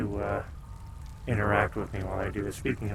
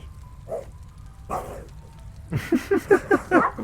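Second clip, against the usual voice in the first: breathless, squeaky laughter; high wheezy laugh. Real, not acted. Laughing at the dog chaos.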